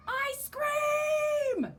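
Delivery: high, loud long call; yelling. Acted. Waffle calls to Ice Cream across recess, high and loud. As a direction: shout it across the playground. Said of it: "yelled Waffle".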